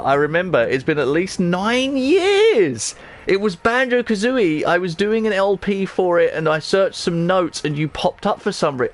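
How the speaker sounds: high-pitched, excited disbelief; amazed. Real, not acted. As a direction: gush in real astonishment, stretching the key words. Amazed a viewer followed for years.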